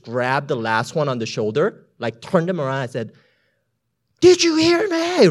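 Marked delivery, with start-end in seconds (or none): 4.2-5.3 very high, loud and slow; shout. Retold or imitated. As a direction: shout over the storm in his face. Grabbing him, shouting over storm.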